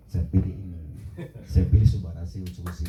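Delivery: low-pitched, mildly resigned concession; retold surrender. Husband yielding to wife after their argument, mock defeated. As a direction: give in, voice dropped low. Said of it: "Detik itu saya nyerah".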